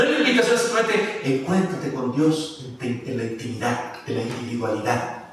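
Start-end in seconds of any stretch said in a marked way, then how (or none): 1.2-5.2 quiet inward aside. Shown as a demonstration. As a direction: murmur the private version you are about to reject.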